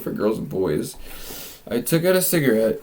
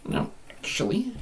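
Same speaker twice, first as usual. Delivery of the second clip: tiny high voice, very soft; hammed-up high, hushed repeat; exaggerated squeaky reply; 12 semitones higher, 6 dB quieter than usual. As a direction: push "actually" up into a soft falsetto. Third, exaggerated take of reply.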